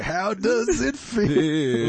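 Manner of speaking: mock Italian accent. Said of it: "He's like".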